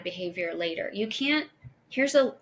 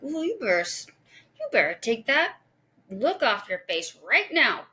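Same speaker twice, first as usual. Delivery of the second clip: threatening parent; 3 semitones higher than usual. Retold or imitated. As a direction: scold like a parent at the end of their patience. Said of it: "I want to be like".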